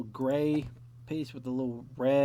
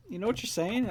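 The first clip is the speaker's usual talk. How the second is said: teacher's question; 9 semitones higher than usual. Retold or imitated. Acted quote of teacher questioning him.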